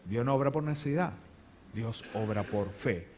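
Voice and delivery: hushed restatement. Acted. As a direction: let the truth sink in quietly. Quiet repetition.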